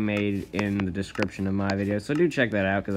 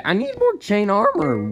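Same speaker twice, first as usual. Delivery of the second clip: sudden squeaky crack in the voice; 10 semitones higher, 5 dB louder than usual. Real, not acted. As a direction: voice cracks up high on armor. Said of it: "What was that voice crack?".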